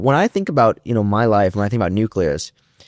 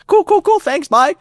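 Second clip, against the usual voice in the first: excited rushed goodbye; 5 dB louder than usual. Retold or imitated. Excited hang-up.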